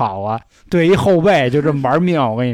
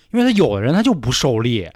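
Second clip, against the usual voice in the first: ordinary talk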